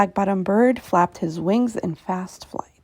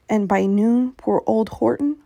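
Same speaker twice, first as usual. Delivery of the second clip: plain reading voice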